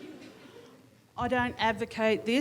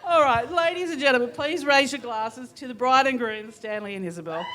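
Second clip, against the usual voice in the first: raised, higher toast announcement; loud toast call; 4 semitones higher, 5 dB louder than usual. Real, not acted. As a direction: call the room to raise glasses. Toast repeated to get attention.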